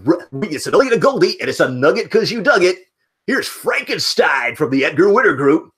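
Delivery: high, loud jock patter; radio DJ voice. Retold or imitated. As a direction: pump it up like an eighties radio DJ. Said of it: "we talked like this".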